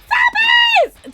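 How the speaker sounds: high, loud gleeful squeal; excited shout. Real, not acted. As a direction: cheer it out loud. Thanking for gifted subs.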